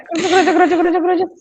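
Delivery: pouring water sound. Retold or imitated. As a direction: mimic water pouring into a glass. Imitating water pouring into glass.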